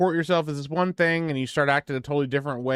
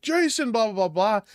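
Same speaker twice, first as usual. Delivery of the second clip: high-pitched imitation of panicked calling; retold panic; freaking out; 7 semitones higher than usual. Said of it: "and they're freaking out".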